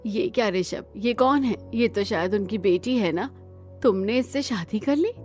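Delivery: shocked disbelief. Acted. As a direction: question the marriage in rising shock. Shocked relative questions secret marriage. (हैरान होकर सवाल करते हुए)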